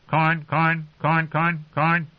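raucous raven. Acted. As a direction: repeat like a screeching raven. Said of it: "the bird's raucous calls".

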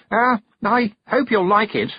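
high, awkward blurt; flustered and shy. A narrator's reading. Young noble hoping his gift pleases, flustered. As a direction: push it out nervously after a hesitation. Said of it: "made Roland blush and stutter".

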